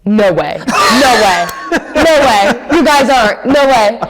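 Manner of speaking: playful disbelief rising to a high pitch; stunned disbelief. Retold or imitated. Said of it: "he goes".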